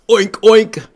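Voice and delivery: two loud, high oinks; pig oinking. Acted. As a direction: oink like a pig. Animals answer Wilford in turn.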